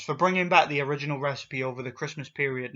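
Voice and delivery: normal talking voice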